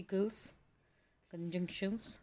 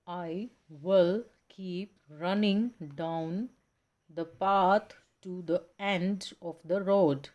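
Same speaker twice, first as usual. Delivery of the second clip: each stressed word hit slow and louder; slow, louder demo of sentence stress; stressed example sentence; 7 dB louder, much slower than usual. Shown as a demonstration. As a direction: read the example sentence stressing the bold words. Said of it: "जितने भी bold में ... stressed syllables हैं".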